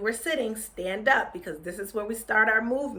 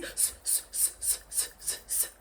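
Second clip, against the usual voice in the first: whispered s sounds; monkey hissing; 16 dB quieter than usual. Acted. Monkeys hiss back at the peddler, whispered.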